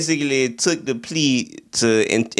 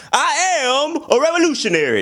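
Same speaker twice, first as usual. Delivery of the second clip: high, ringing revolutionary orator; Fred Hampton impression; 13 semitones higher than usual. Reviewer imitating the actor's Fred, high orator voice. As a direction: declaim it like a fiery leader. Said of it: "He got a lot of it down when he was".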